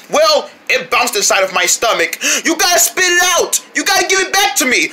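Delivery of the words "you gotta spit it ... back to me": high, urgent shouted order; insistent demand. Acted. Demands ball back.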